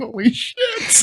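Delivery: high, soft, slow exclamation; hushed alarm. Acted. Recruits in their racks after the yell, hushed alarm. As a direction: breathe it out in mock dread.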